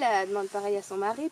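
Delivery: usual speaking voice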